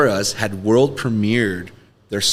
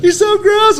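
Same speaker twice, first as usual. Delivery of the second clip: much higher, louder squeal of disgust; mock girls' disgust; 7 dB louder, slower than usual. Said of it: "all the girls would be like".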